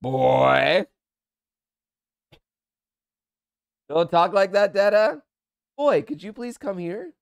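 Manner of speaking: dad scolding his son, then pleading please; scolding call. Real, not acted.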